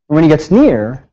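normal talking voice

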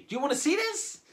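high-pitched, teasing question; rousing the audience. Acted. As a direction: ask it high to whip up the crowd. Asks again after pretending not to hear.

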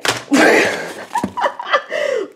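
high breathless giggle; squealing delighted laugh; giddy laugh. Real, not acted. Overjoyed at CD haul.

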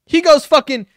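ordinary talk

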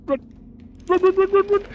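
high, loud frantic yelling of run; panicked shout. Real, not acted. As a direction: scream at yourself to flee. Fleeing monsters in horror game.

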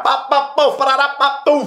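rapid high-pitched gunshot noises; pow-pow sound effects; imitated gunfire. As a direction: mouth the shots of a shootout. Imitating gunfire in his story.

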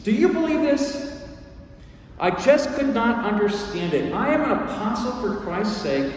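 high-pitched incredulous complaint; exasperated disbelief. Acted. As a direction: protest as if wronged and baffled.

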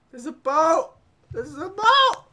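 put-on loud, rising, childlike excitement; play-excited discovery; excited pointing out. Spots a boat in game.

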